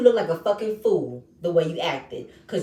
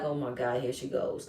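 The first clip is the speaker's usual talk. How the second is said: much softer, low breathy aside; mock muttered aside; 10 semitones lower, 9 dB quieter than usual. Imitating Fonzo muttering as Shay goes off. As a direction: mutter it under your breath.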